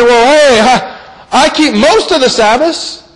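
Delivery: mock protest. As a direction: argue your case like someone caught out. Imagined sinner replies defensively.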